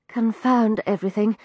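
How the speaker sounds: usual speaking voice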